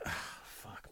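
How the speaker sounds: breathless whispered swear; whispered curse. Retold or imitated. The cornered couple, cursing in a whisper. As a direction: mutter it under your breath in defeat. Reed and Gloria realise defeat.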